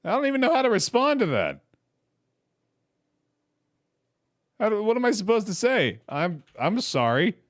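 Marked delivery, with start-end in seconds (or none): 0.0-6.0 flustered. Real, not acted. Player flustered by dark story.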